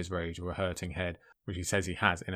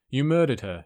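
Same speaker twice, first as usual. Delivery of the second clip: accusing; 5 semitones higher, 6 dB louder than usual. A narrator's reading. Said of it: "he said, dodging".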